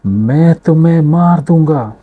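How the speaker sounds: high, loud furious threat; a boy threatening the voice he can't see; child yelling a threat at the hills; angry threat. Acted. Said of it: "बच्चे ने गुस्से में कहा".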